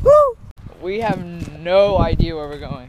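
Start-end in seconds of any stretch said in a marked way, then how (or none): 0.0-0.4 excited whoop. Real, not acted. Cheering on a trip.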